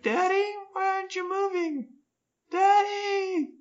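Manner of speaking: high, mock-tearful child; hammed-up child calling daddy; kid crying for daddy. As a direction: wail like a little kid for daddy. Kids voiced calling dad.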